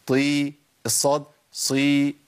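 "طي" and "صي" heavy, the "ee" drawn out slowly; heavy itbaq letters. Shown as a demonstration. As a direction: keep ط and ص emphatic in long "طي" and "صي". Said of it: "الأربعة الإطباق أقوى من الثلاثة". ("طي" و"صي" بتفخيم الإطباق)

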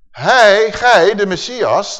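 loud, high, slow proclamation; ringing out a psalm; scripture declamation. Acted. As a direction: proclaim the verse with long, forceful opening words.

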